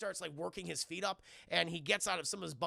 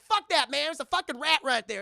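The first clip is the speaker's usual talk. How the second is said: mock outburst; 8 semitones higher, 10 dB louder than usual. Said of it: "Chris Tucker's like".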